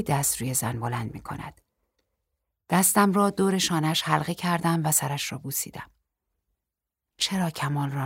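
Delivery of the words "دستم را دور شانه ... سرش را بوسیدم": ordinary delivery, nothing marked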